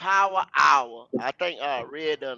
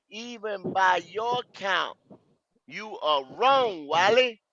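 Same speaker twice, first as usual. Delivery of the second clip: over enunciating; 3 semitones higher, much slower than usual. Retold or imitated. Reading a comment to correct Wiley.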